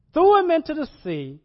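loud, high climax of the throw; dramatic. Acted. Preacher telling Jonah thrown overboard, loud and high. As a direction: hurl the words like the throw.

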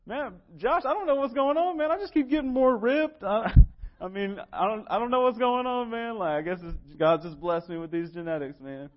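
preacher mimicking his muscular little brother, high and loud mock bro voice; little brother impression. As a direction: play the carefree gym-bro brother baffled by his own gains. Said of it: "he's like".

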